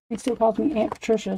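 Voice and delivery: ordinary talk, nothing marked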